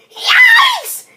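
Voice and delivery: very high, loud yelp; squealed yell of alarm. A narrator's reading. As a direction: shriek it as if suddenly stung. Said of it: "he yelled".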